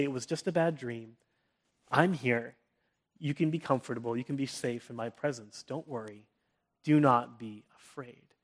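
put-on calm a child after a nightmare; comforting a child. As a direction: parent soothing son after a bad dream. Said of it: "they want that comfort and that reassurance".

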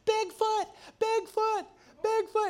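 very high-pitched mock panic cries; imitated terrified scream. Imitating a kid running to his mom, mock terrified screaming. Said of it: "starts screaming at the top of his lungs".

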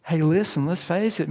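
normal talking voice

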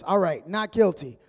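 judge's firm ruling; loud verdict. Retold or imitated. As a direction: declare it like a gavel.

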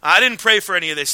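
loud, startled protest; mock-alarmed denial to God. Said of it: "I said".

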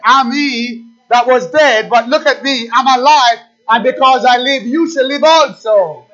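loud, high, ringing sermon climax; preaching at full force. Acted. Pastor proclaims Christ risen to the church, loud full-force preaching.

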